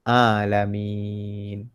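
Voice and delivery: very slow "Alamin", A from mid-throat; correct ain. Shown as a demonstration. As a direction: recite "Alamin" with long held vowels. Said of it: "A tengah kerongkong".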